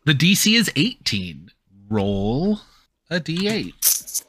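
ordinary talk

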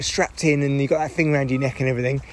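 ordinary delivery

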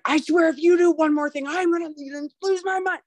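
high-pitched exasperated parent; losing patience. Acted. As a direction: act out a parent at the end of their rope. Imagined parent snapping under stress.

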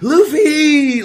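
very high, loud, laughing cry; excited shout. Retold or imitated. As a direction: squeal the name with delight. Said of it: "Yamato's like".

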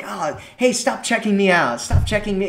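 high, playful teasing; flirty tease. Shown as a demonstration. Woman teasing the man she likes, flirty and playful. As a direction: tease him lightly, all fun. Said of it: "in a fun, kind of flirty kind of way".

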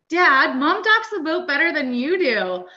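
put-on child's teasing voice. Son telling dad mom docks better, high and slow teasing. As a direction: play the proud little son ribbing his dad.

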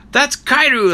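high, loud indignant yell; mad outburst. Retold or imitated. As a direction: shriek it out, outraged. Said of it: "Like, he gets all mad".

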